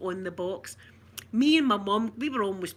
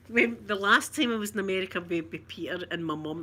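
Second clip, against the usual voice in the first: ordinary talk, nothing marked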